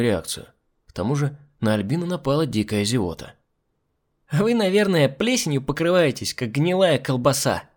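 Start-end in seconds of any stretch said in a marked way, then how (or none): 4.3-7.6 put-on higher-pitched cheeky child's voice; impudent little girl. A narrator's reading. Little brat diagnosing the hostess, impudent. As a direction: declare it with brazen childish certainty. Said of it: "заявила маленькая нахалка".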